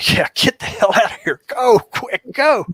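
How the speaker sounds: mock urgent get out; urgent shooing. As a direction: push him out the door fast. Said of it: "And I'm like".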